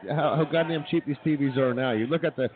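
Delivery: normal talking voice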